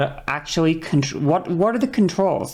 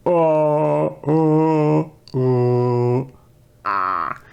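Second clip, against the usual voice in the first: held vowel tones stepping downward; messing around vocally; much slower than usual. Shown as a demonstration. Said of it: "just mess around with it".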